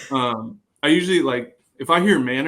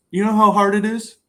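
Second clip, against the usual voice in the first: raised, higher mock-stern challenge to the heckling kid; coach scolding a heckler; 8 semitones higher, 6 dB louder than usual. Bullpen coach confronting a heckling teenager, in a raised stern voice. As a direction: put the loud kid in his place with a sharp high demand. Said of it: "And he goes".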